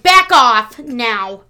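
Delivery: loud, slow, drawn-out warning; mock stern shout. Mom warning her kids off the Christmas decorating, loud and slow. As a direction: bark it at the kids, slowly. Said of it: "And I'm like".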